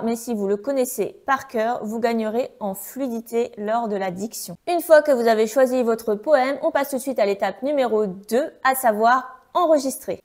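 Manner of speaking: normal talking voice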